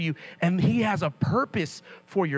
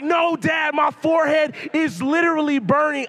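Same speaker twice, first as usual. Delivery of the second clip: loud, higher, desperate yell; panicked shout; 8 semitones higher, 5 dB louder than usual. Retold or imitated. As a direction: shout over him in alarm. Panicked reply to praying dad.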